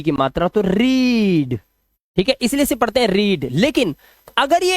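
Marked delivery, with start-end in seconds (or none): 0.6-1.6 रीड said slowly with a long "ee"; long ee sound. Shown as a demonstration. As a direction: stretch out the vowel in रीड. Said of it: "आप लगाएंगे बड़ी".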